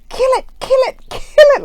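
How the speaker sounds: very high, loud mock shriek, repeated urgently; panicked shrieking. Retelling her order to the boss holding a spider, panicked shriek. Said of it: "like this".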